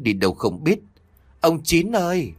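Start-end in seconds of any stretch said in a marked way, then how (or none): 1.4-2.3 high, drawn call of his name. A narrator's reading. Wife calls toward the pond for her husband, calling out. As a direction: call for someone out of sight, pitch raised.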